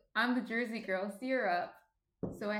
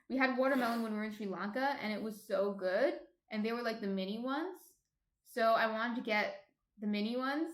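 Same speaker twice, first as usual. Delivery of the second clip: usual speaking voice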